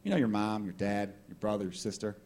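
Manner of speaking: low, soft solemn address; candidate's podium speech. Acted. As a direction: open a speech gravely and quietly. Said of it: "guy or girl at the podium".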